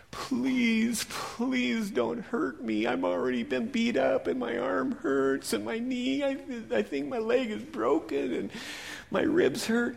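high, slow, scared begging; frightened plea. Acted. Beaten man begging the Samaritan, frightened and high. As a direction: plead with the enemy not to hurt you. Victim fearful of enemy.

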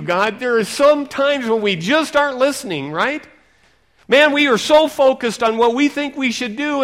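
loud, high fervent preaching; full-force revival preaching. Acted. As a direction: preach it out to the hall.

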